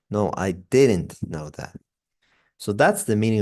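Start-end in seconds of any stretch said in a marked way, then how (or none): 0.1-1.8 DIDN'T stretched and punched; slow down and lean on "didn't"; stress on didn't. Shown as a demonstration. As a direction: make "didn't" long and strong. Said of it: "we make it longer and stronger".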